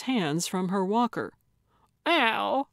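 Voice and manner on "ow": short, high yelp; acted, higher-pitched ouch; cry of pain. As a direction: cry out as if hurt. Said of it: "she cried".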